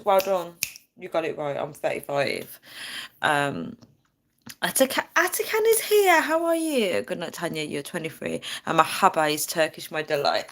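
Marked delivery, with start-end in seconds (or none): 4.6-7.0 high, delighted welcome; light up as you greet him; excited greeting. Real, not acted. Streamer welcoming a viewer who just arrived, high and delighted.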